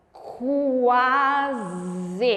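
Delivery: drawn out very slowly; syllable by syllable in slow motion. Shown as a demonstration. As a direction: stretch every sound of Quase. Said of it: "diga em câmera lenta".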